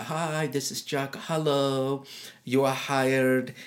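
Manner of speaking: slow, drawn-out boss announcing the hire; boss on the phone. Retold or imitated. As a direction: spell it out slowly like Jack did. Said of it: "He said".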